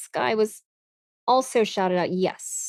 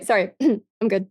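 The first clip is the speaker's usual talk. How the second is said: ordinary delivery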